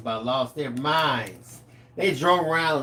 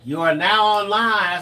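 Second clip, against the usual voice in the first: slow, loud, higher proclamation; mock announcement; 5 semitones higher, 6 dB louder than usual. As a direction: proclaim it like an initiation verdict. Retold pledge announcement.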